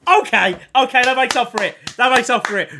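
excited relief. Real, not acted. Streamer finally pulls a good player, loud excited relief. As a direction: burst out with relief.

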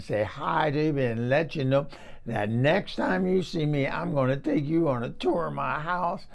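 put-on chatty Southern old lady gossiping to a neighbour; old woman telling her neighbour about the truck; Eunice character voice. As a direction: spin a rambling yarn as a folksy old woman. I may be talking like this.

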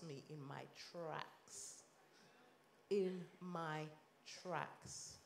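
whispered, breathy repeat of the phrase; hushed. Real, not acted. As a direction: let it fall to a whisper for effect. Dramatic hushed repetition.

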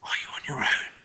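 urgent breathless whisper; a secretive whispered question; hissed whisper. A narrator's reading. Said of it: "Her voice dropped to a hiss".

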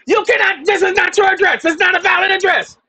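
retelling the clerk's shrill yell at the counter; mock shouting postal clerk. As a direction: shout it back the way she yelled. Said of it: "she yells at me".

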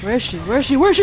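anxious. Real, not acted. Searching in panic.

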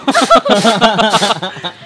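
loud laugh at the heat; burst of laughter. Real, not acted. Touching the hot seat.

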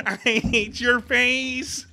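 high, very slow alien mimicry through laughter; imitated alien voice. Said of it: "the most stellar alien voice".

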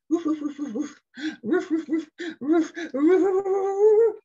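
high, loud woofs building to a howl; playful dog barking. Pretend. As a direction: bark like an excited doggy. Imitating the doggy for a child.

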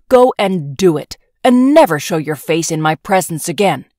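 loud, relentless berating; furious scolding. Acted. Emperor disowning his daughter, furious scolding. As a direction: pour scorn on her.

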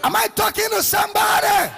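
high, loud call to the crowd; rousing shout. Real, not acted. Preacher challenges congregation for response, rousing shout.

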